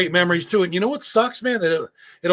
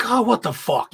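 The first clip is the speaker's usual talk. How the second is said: higher, exasperated retold outburst; mock exasperation; 6 semitones higher than usual. Husband seeing he bought hot dog rolls, mock exasperated. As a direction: replay your dismay, pitched up.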